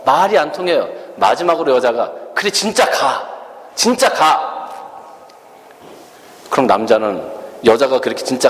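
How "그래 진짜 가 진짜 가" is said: high hammed-up "go, really go"; mock final ultimatum. Girlfriend giving her final warning, mock angry.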